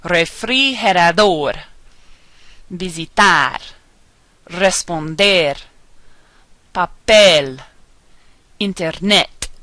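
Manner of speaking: refrigerador, visitar, papel: hit the last syllable; careful word list, visiTAR, paPEL, each word separate; final-syllable stress. Shown as a demonstration. Teacher reading examples, stress on last syllable as in paPEL. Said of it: "put the stress that we say on the very last".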